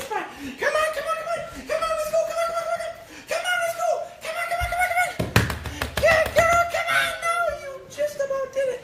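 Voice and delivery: very high-pitched frantic coaxing and praise; excited puppy calling. Real, not acted. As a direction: squeal encouragement to keep the puppy coming. Urging and praising puppy again.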